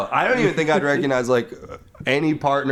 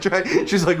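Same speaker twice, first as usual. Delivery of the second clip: ordinary talk, nothing marked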